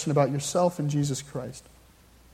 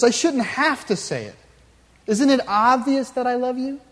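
lightly voiced husband, higher and indignant; defensive husband; 7 semitones higher than usual. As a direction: protest like a husband caught off guard. Husband defends himself in story.

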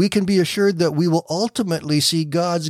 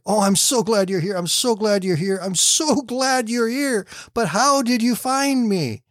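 relieved joy; 8 semitones higher than usual. Acted. Rescued man greets sailor.

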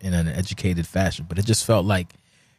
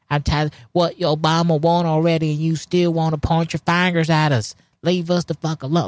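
mock aggrieved complainer; 9 semitones higher than usual. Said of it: "who were basically like".

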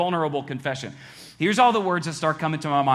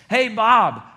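loud, higher-pitched mocking call-out; joking sarcasm; 6 semitones higher, 8 dB louder than usual. Coworker calls out Bob before the room, joking and sarcastic. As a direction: tease him in front of everyone. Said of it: "in a joking, sarcastic way".

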